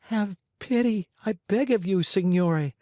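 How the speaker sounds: high, pleading little voice; pitiful begging. Acted. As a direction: beg for mercy in a small voice. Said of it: "in a pitiful voice".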